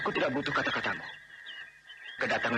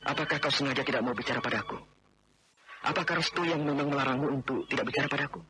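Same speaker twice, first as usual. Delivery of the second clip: ordinary talk, nothing marked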